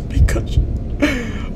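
soft, high, frightened catchphrase; scared Pikachu. Real, not acted. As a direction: say it as a small scared comfort word. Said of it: "every time I get scared now".

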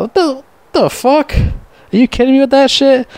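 high, incredulous outburst; can't believe what he's hearing; a sudden high-pitched what-the-hell; outraged disbelief. Real, not acted. Outraged at SpongeBob losing the job.

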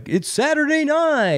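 high, slow mock announcer call; SNL announcer. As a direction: belt it like the show's opener. SNL opening announcer parody.

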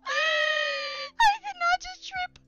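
panicking gamer. Real, not acted. Streamer trips, screams in fright.

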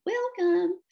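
very high, loud little-creature call; echoing baby alligators. A narrator's reading. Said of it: "called out an echoing".

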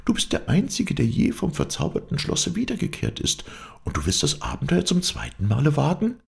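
very high princess voice; astonished princess. Acted. Princess questions the knight's second adventure, high and astonished.

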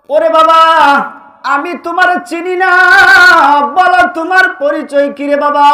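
high, loud cry of a mother; acted anguished mother calling. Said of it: "মা ডাক দিয়ে বলেন".

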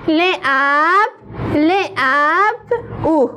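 لبلبو broken into slow separate sounds; sounding out letters. Shown as a demonstration. (صداکشی حرف به حرف)

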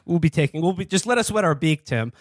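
ordinary talk, nothing marked

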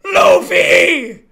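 very loud, stretched-out cry; full-throated drawn-out shout; hyped yell. Real, not acted. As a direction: scream the name like an anime fan. Yells character name over keyboard sounds.

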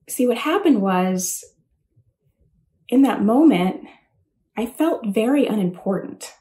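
ordinary talk, nothing marked

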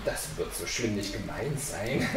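low, soft inward murmur; thinking to himself. A narrator's reading. Sexton thinks the threat is not serious, low private murmur. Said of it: "Der Küster dachte".

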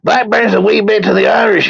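high, hammed-up Irish voice; comic Irish accent, pitched well up. Joking that Blackburn's a wee bit Irish, mock Irish accent. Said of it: "[name] with an Irish accent".

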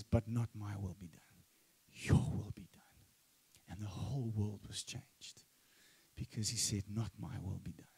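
soft, low near-whisper; hushed prayerful whisper, almost gone; hushed surrender. Retold or imitated. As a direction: breathe it out in quiet surrender. Jesus surrendering in Gethsemane.

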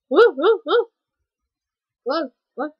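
loud, high startled whoas; loud startled yelps at the game. Real, not acted. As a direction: yelp in alarm at the screen.

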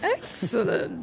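slow villain voice. Pretend. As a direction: stretch "Excellent" like a gloating villain. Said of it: "You sound like a villain".

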